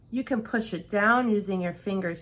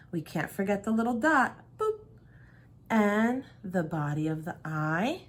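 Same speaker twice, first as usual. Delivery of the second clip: ordinary delivery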